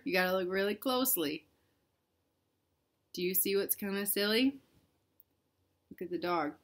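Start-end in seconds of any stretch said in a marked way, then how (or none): none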